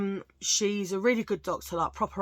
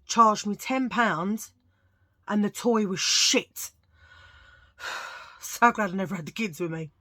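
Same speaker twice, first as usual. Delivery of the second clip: ordinary talk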